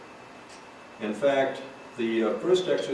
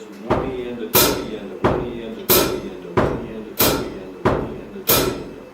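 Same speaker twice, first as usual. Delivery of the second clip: counting the subdivisions; 4 dB louder, faster than usual. Shown as a demonstration. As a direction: rattle off the count faster and faster. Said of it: "I can actually think of it as".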